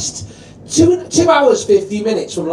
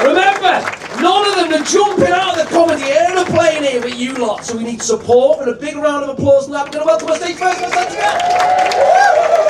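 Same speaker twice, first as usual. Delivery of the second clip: much higher, shouted crowd hype; compère hype; 9 semitones higher than usual. Real, not acted. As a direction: whip the audience up. Hyping the crowd as host.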